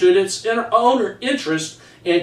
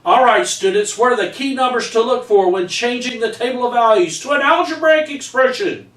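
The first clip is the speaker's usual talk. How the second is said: higher and fast, lively classroom voice; teacher to class; 6 semitones higher than usual. Retold or imitated. Teacher asking the class a math question, brisk and lively. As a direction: quiz the whole class briskly.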